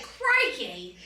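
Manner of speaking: high, loud mock Australian; loud mock Australian crikey; Australian accent. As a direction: ham up the Australian host. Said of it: "he's like".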